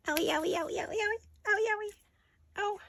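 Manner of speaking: high mock whimper, owie over and over; playful whining. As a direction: whine like a hurt child, half joking. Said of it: "Whining has commenced".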